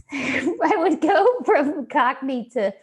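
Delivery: amused, laugh bubbling into the words. Real, not acted. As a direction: crack up while confessing. Laughs while recalling bad accents.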